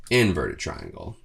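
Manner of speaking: usual speaking voice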